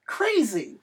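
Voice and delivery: normal talking voice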